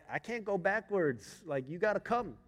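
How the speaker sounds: retold dad pleading in exasperation; exasperated urging. Dad urging balking daughter.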